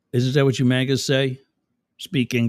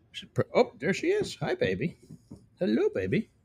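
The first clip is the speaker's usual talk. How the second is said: higher and softer, cooing; 10 semitones higher, 7 dB quieter than usual. Real, not acted. Host spotting his dog and cooing hello to her. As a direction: coo at a pet.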